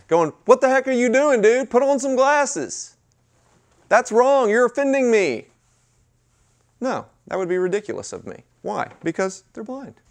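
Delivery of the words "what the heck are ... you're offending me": high, loud mock outrage; mock mad. Host scolding a blind guest for knocking things over, mock mad. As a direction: yell indignantly, as a hammed-up overreaction. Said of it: "And I start getting mad".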